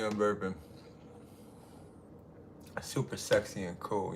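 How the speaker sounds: ordinary talk, nothing marked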